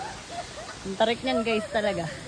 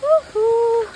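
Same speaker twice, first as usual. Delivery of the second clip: loud, high cheer; excited whoop; 6 semitones higher, 7 dB louder than usual. Real, not acted. Cheering loudly while climbing the falls trail, excited. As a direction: let out a happy whoop.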